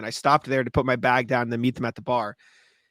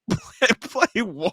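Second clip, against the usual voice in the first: high, very slow puzzled question; baffled owner; 7 semitones higher, much slower than usual. Retold or imitated. Pizza owner confused by a song request, high and bewildered. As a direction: imitate a man totally lost by the request.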